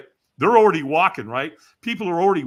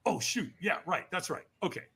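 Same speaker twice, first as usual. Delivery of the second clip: soft, hurried realization; quiet retold self-correction; sheepish self-reminder; 3 semitones higher, 8 dB quieter than usual. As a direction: mumble it to yourself, caught out. Startled, remembering the instruction.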